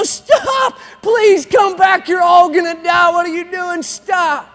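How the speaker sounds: high, loud shouted pleas; frantic shout. Retold or imitated. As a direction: scream at them to come back. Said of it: "all the other frogs go".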